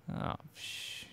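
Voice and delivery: whispered curse, barely voiced; muttered under breath. Real, not acted. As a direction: swear under your breath in alarm. Startled under breath in game.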